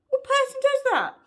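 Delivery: loud, squeaky outrage, retold; high-pitched incredulity. Reacting to housemate's lost temper, high and incredulous. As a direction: shoot way up in mock disbelief.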